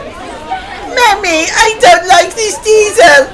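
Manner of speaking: put-on high-pitched, slow wail of a small child; upset little boy tattling to mummy; crying little boy. A narrator's reading. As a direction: whine it tearfully in a very high child's voice. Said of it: "he cried".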